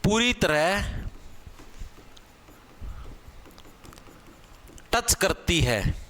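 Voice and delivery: slow, word by word with long pauses; teacher dictating the definition slowly; dictation pace. Shown as a demonstration. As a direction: dictate it so they can write. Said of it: "definition repeated for dictation".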